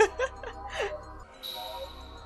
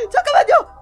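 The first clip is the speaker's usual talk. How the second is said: panicked shout; 14 dB louder than usual. Real, not acted. Panicked plea.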